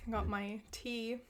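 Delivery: ordinary talk, nothing marked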